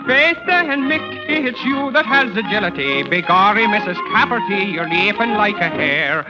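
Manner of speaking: ordinary talk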